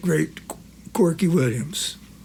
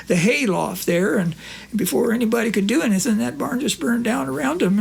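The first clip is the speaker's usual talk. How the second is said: higher, lightly voiced old country friend; Corky's voice; 5 semitones higher than usual. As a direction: play the innocent old pal.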